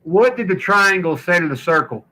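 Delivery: ordinary talk, nothing marked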